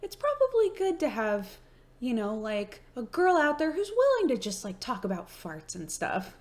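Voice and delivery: high, light imitation of her mom; mom's voice. Mom approving of her fart talk, high imitation. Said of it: "And she was like".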